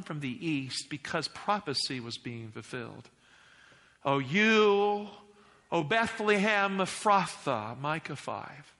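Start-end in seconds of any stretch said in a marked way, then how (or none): 4.0-7.8 raised, measured pulpit reading; ringing scripture proclamation; declaimed scripture. Acted. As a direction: proclaim the prophecy to a congregation.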